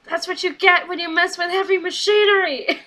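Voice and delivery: high, hammed-up victory shout; put-on triumphant holler; triumphant wrestler. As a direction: crow it like a winning wrestler. Otis's triumphant catchphrase, imitated.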